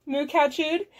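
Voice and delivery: ordinary delivery, nothing marked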